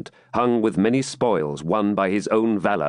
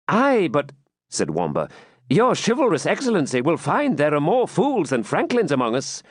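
high, impudent fool's voice; cheeky jester; 8 semitones higher than usual. Acted. Captive jester mocking the baron, high cheeky voice. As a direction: toss off the quip like a jester.

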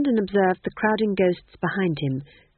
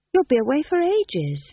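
put-on young woman voice; 9 semitones higher, 5 dB louder than usual. Magrat voiced.

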